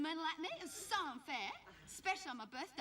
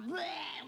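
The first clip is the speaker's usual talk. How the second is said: mock gagging "bleh"; retching noise. Class reacting in disgust to Amanda.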